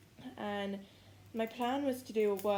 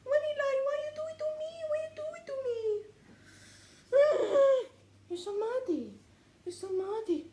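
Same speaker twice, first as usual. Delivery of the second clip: much higher, whiny sing-song at the dog; 5 dB louder than usual. Real, not acted. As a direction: plead with the dog in a squeaky voice. Talking to dog Winnie.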